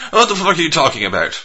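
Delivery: high-pitched, baffled Cockney; Cockney accent. Acted. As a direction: grumble back in mock Cockney. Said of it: "you know, Cockney and shit".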